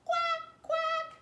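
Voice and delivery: very high, loud quacking; duck quack. Acted. Puppy George quacking, very high and loud. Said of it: "George went".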